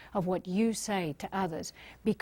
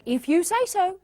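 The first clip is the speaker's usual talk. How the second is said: higher-pitched, spirited delivery; energetic, with conviction; 8 semitones higher than usual. Shown as a demonstration. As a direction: say it with life and conviction behind it. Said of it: "give them some energy".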